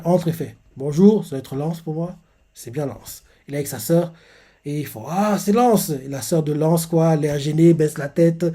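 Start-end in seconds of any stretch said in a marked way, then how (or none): none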